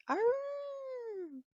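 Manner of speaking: a wolf answering from across the forest; distant wolf howl. A narrator's reading. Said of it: "off in the distance, he heard".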